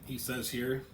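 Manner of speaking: ordinary talk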